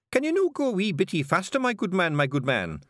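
high-pitched, pushy Scottish passenger; impatient Scottish stranger. Acted. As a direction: urge the driver on in broad Scots. Stranger urges carter on.

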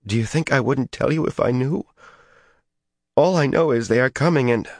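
high, strained tearful voice; near tears. A narrator's reading. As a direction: close to crying, pleading.

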